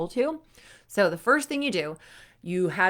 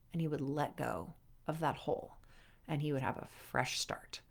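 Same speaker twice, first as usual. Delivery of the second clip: hushed whisper; 4 semitones lower, 11 dB quieter than usual. Acted. Calming the listener after Tiger's stomping, in a whisper. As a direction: drop to a soft whisper as the anger lets go. Calm release after anger.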